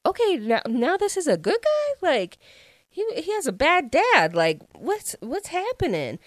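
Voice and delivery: high, bewildered questioning; baffled. Retold or imitated. Reader recapping her confusion at the plot twist, baffled. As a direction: act out confused disbelief, pitch jumping up.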